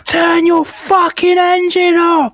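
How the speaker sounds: high, drawn-out mock scream; imitated screaming woman. Woman yells down at boater from tower block, imitated screaming. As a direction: scream it slowly from a tower block, far above your own pitch. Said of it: "this woman's just fucking screaming".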